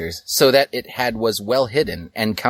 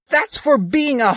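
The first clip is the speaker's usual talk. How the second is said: much higher taunting yell; high mock insult; 4 dB louder than usual. Link after punching Sasuke, a high taunting yell. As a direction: sneer it like a schoolyard taunt.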